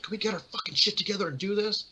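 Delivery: mock-irritated demand, not shouted; Bill Murray. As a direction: mimic a fed-up star rallying everyone. Bill Murray fed up, retold.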